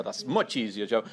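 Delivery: usual speaking voice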